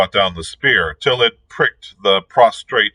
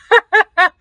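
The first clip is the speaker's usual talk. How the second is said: high cackling laugh; witch cackle; 6 dB louder than usual. A narrator's reading. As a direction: cackle mid-sentence. Witch laughs mid-speech.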